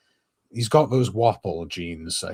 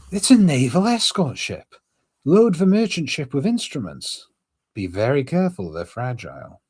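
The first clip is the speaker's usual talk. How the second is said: loud raised order voice; commanding officer voice; 8 semitones higher, 4 dB louder than usual. Acted. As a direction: bark orders to the crew.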